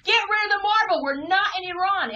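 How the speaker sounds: high, loud scolding rant; mock yelling at wife. Comic telling off a nervous wife, loud and high. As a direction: bark it like a fed-up spouse. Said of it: "I'm like".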